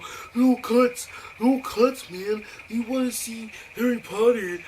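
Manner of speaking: high, loud, slow mocking voice; mock refusal. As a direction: taunt people who want to cut. Friends mockingly refusing cuts.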